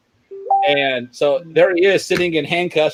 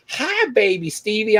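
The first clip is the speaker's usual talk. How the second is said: mock cooing tease; 7 semitones higher than usual. Inmates teasing the jailer.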